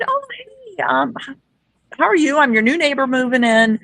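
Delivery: high-pitched, eager hello to a neighbor, retold; re-enacted neighborly hello, starting very high; friendly greeting. Retells greeting the new neighbor.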